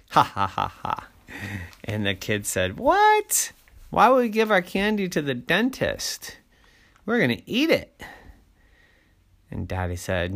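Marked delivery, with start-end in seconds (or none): 0.1-1.1 spelt-out "ha ha ha", dying away; dad laughing at his own joke; put-on chuckle in separate beats; teasing laugh. Daddy's teasing laugh in story. 2.8-8.0 put-on very high, outraged child voice; high-pitched childish indignation; indignant kids. Kids protest losing candy.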